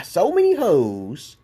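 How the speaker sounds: drawn-out punchline pitched high; slow high punchline. Acted. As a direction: land the joke slowly and high. Punchline of the wordplay.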